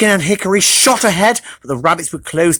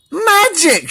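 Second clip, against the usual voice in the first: excited shout; 11 semitones higher than usual. Acted. Hickory cheering at the magic nuts, high shout. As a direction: shout with joy. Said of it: "shouted Pecan".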